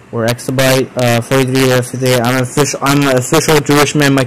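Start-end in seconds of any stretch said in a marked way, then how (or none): none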